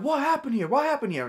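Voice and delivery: high mock demand, repeated; drunk stranger. Drunk stranger stops chasing and demands answers, high mock voice. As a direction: imitate the drunk's insistent challenge. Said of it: "he starts going like".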